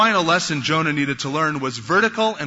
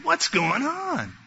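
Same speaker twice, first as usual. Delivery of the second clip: slow, drawn-out baffled question, pitched higher; mock bewilderment; 4 semitones higher, much slower than usual. Onlooker puzzled by yelling couple's puppy love, drawn-out bafflement. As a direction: stretch it out in disbelief.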